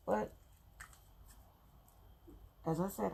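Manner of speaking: ordinary delivery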